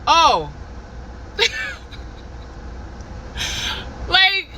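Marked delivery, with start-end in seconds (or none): 0.5-4.0 laugh. Real, not acted. Laughs at her own story.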